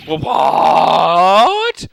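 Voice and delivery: loud stunned stammer; shocked gasp. Acted. Villain reacts in loud shock to the accusation. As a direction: blurt it out in total shock.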